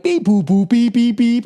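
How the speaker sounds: high, loud robotic beeping; flying saucer beeps. Retold or imitated. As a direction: beep like a spaceship. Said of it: "going like".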